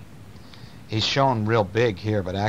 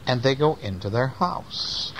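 ordinary delivery

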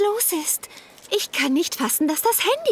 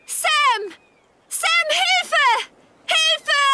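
high desperate shout; shouting for help; 12 semitones higher, 3 dB louder, much slower than usual. Acted. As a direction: yell for help from afar. Ruft aus der Ferne um Hilfe.

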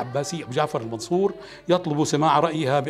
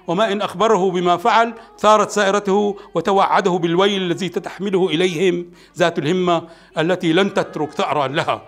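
ordinary delivery

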